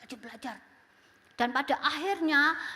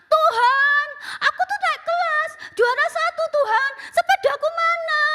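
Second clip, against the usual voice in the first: put-on much higher and louder pleading child; louder prayer; 12 semitones higher, 10 dB louder than usual. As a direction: raise the voice so God will hear. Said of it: "dikerasin suaranya". (doa anak dengan suara dikeraskan)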